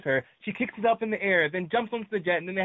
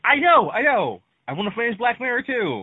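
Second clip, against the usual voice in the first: loud, higher-pitched defensive protest; exasperated protest; 5 semitones higher, 6 dB louder than usual. Real, not acted. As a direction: protest loudly that you already know. Nagged about an unfinished show.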